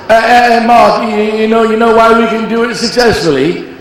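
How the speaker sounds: [name] impression. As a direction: stumble into the question as [name]. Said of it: "And then he says".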